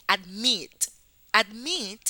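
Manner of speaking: "admit" stressed on the second syllable; stress on last syllable. Shown as a demonstration. Teacher presenting the next example, stress on the end of admit. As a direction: punch the "mit" in "admit". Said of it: "tiene la sílaba tónica".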